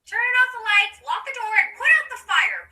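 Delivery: loud, high list of commands; bossy orders. Acted. Polly tells Wendy to hide at home, bossy. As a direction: order a little sister about, item by item.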